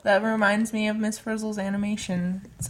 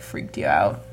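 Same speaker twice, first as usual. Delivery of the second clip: usual speaking voice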